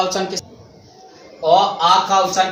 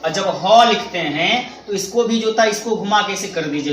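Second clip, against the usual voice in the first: ordinary talk, nothing marked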